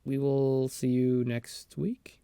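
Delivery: ordinary talk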